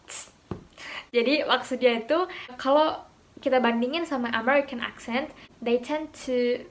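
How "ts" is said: "Ts" as a bare voiceless consonant; a crisp, hissed "Ts" with no vowel; true T sound. Shown as a demonstration. Teacher showing learners the T sound, voiceless "Ts". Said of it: "Bukan T sih".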